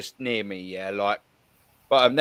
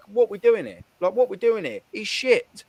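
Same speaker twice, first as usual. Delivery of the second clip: high, mock-indignant fan rant; exasperated rant; 7 semitones higher than usual. Fan retelling scolding an older supporter, high mock outrage. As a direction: tell the old boy off, pitched up.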